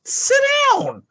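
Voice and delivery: very high, loud mock command; squeaky command. Owner telling the dog to sit, in a squeaky high voice. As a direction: squeak it out like a cartoon order.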